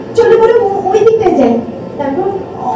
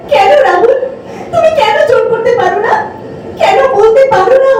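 high-pitched, pleading reproach; pitched-up lover's complaint; frustrated reproach; 6 semitones higher than usual. Acted. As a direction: demand to know why, exasperated. Reproaching a too passive lover.

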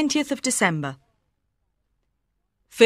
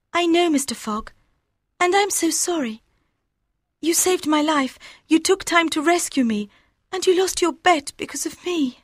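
much higher, gentle female voice; tender young woman; 8 semitones higher than usual. A narrator's reading. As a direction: Aouda reassuring Fogg.

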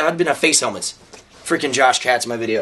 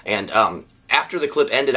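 ordinary talk, nothing marked

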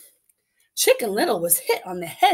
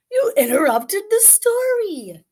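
papa scolds; 12 semitones higher, 5 dB louder than usual. Acted.

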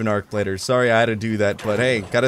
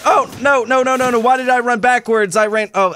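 high, loud frantic no-no-no; panicked; 10 semitones higher, 5 dB louder than usual. Real, not acted. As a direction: panic as you back away. Panicking under fire.

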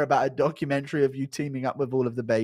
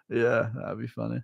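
normal talking voice

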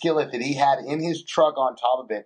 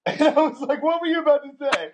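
high, fast mock-stern question through laughter; laughing mock scolding; 13 semitones higher than usual. Real, not acted. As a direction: pretend to catch him out, laughing. Catching son's near-cuss.

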